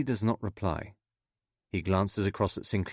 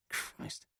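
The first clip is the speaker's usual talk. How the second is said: whispered curse; 12 dB quieter than usual. A narrator's reading. Stunned reaction to election news.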